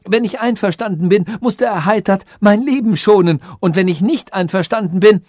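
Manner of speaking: high, urgent arguing; insistent high-pitched plea; desperate cry. A narrator's reading. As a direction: argue frantically for your life. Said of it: "rief der Weise".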